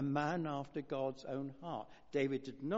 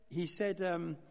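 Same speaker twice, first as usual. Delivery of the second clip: normal talking voice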